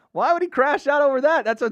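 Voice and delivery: can't believe it, shout the question; incredulous outburst. Real, not acted. Streamer reacting to fighter losing his temper, loud incredulous question.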